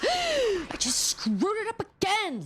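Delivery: high, slow gasp of dismay; gasp, then groan at the mistake. Real, not acted. Drawing mistake, gasps.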